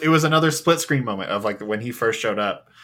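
ordinary talk, nothing marked

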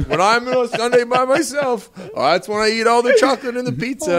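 mock bandmate confessing his chocolate day; Nick impression. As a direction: gush over a secret Sunday binge. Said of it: "doing the full impression".

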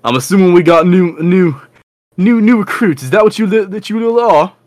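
high, loud, sneering brute voice; high mocking brute. Horned red brute jeering at the new recruits, high and loud. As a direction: jeer up high at the newcomers.

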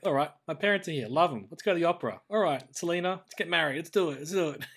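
lightly acted eager rush; eagerly digging in. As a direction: rattle off plans as if diving right in. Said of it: "really digging in".

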